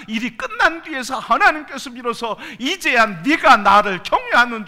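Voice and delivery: loud, high, fervent preaching; full-force preaching. Real, not acted. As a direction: build the climax and drive it home.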